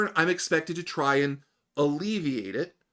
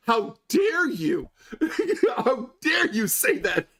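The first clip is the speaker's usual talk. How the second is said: high, drawn-out mock indignation breaking into laughter; mock outrage; 10 semitones higher, slower than usual. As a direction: imitate an offended listener, slowly and hammed-up. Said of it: "I love how [name] went from".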